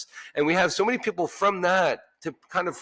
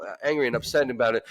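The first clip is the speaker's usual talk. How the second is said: normal talking voice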